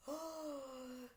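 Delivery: quick soft startled gasp; sharp gasp. Acted. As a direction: gasp as the thieves appear. Thieves appear through trapdoor.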